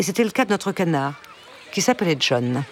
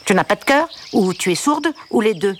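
irritated, nasal; 4 semitones higher than usual. A narrator's reading. Tearful duck losing patience with an unresponsive girl, irritated and nasal. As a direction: fire off exasperated questions through the nose. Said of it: "le canard s'énerva".

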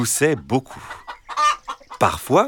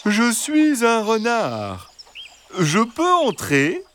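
gentle, reassuring fox; 13 semitones higher, slower than usual. Acted. Fox asking three hens to let him in, gentle and reassuring. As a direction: coax them sweetly in a high drawn-out voice. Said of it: "Une voix douce et rassurante".

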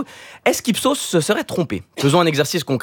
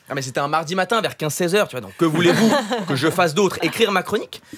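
normal talking voice

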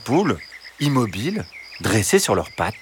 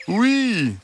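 high sweet fox reply; 13 semitones higher than usual. Acted. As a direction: answer brightly as the fox. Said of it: "répondit-il".

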